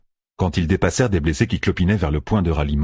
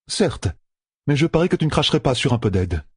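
higher, fast teasing offer; a woman offering help with a tease; 7 semitones higher than usual. A narrator's reading. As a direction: needle him playfully. Pevara teasing.

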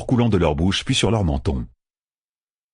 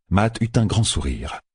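plain reading voice

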